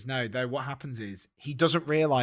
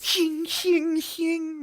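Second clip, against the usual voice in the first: very high, louder sung shing; knife-sharpening noise; 5 dB louder, much slower than usual. Acted. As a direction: sing the blade sound high and sharp. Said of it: "Shakespeare's like".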